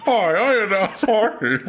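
high, put-on hockey announcer. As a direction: play a corny TV announcer. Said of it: "That's your announcer voice".

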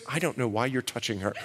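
low, soft, quick aside of a mom; hushed mother. Retold or imitated. His mom scolding him in the delivery room, low and soft.

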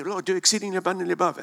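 loud, fast, eager pleading; fervent prayer. Retold or imitated. Preacher mimicking people praying, fast and loud. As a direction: rattle it off like a hungry believer. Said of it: "We, we, people pray".

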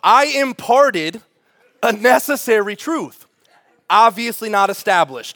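loud, slow, emphatic pronouncement; emphatic preaching. Acted. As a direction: drive the point home, slowly and loudly. Preacher emphasizing key point.

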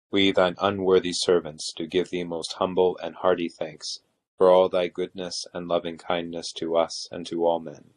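usual speaking voice

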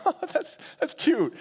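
high, light cooing amusement; amused condescension. Shown as a demonstration. Preacher shows what God's laugh is not, high cute-amused voice. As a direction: coo it lightly, high-pitched. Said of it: "It's not like a".

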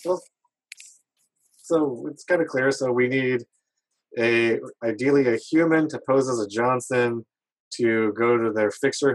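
normal talking voice

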